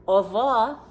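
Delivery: put-on cheery old knitter's au revoir; fond goodbye. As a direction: call goodbye across the park. Old lady bids lion goodbye.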